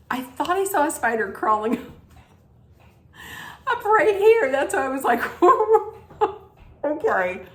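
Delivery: laughing through the words, high bursts; snorting laughs. Real, not acted. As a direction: giggle while explaining the spider. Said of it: "that was a snort".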